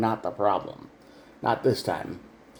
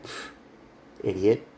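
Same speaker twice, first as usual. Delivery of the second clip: scornful scoff; 4 semitones lower, 3 dB quieter than usual. Retold or imitated. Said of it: "they're just like".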